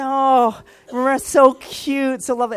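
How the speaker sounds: high, loud, drawn-out cooing; mock gushing. Parent looking at pictures of the sleeping baby, mock gushing. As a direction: imitate a besotted parent cooing.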